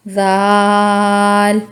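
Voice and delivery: जाल held long, three seconds; three-second zaal. Shown as a demonstration. Teacher holds जाल long to show its length. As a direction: stretch जाल slowly. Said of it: "इसमें भी तीन हरूफ हैं".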